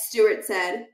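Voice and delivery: ordinary delivery, nothing marked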